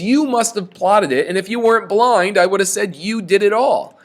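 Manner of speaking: high, loud raging accusation; full rage. Retold or imitated. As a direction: hulk out at the blind seer. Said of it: "he goes full rage mode here".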